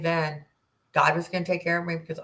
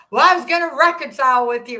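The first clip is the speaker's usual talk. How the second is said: louder, higher retold yell; mock yell; 5 semitones higher, 7 dB louder than usual. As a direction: throw his indignant line back. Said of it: "He starts yelling at me".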